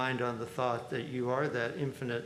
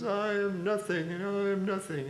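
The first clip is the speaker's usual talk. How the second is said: high mock lament in a put-on voice; exaggerated humility, pitched well up; custodian impression; 7 semitones higher, 3 dB louder than usual. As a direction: play a custodian joining the humble wailing. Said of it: "the custodian comes up and says".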